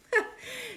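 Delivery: short high giggle; high-pitched little laugh; squeaky laugh. Real, not acted. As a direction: giggle at yourself. Laughs about her accent.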